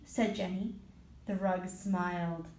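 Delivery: ordinary delivery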